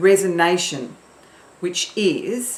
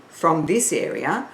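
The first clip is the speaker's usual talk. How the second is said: normal talking voice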